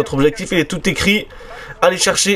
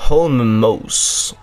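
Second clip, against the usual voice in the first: put-on English accent; 4 semitones lower than usual. Repeats English place name.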